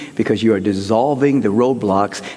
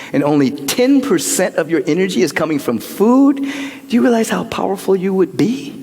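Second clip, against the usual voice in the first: ordinary talk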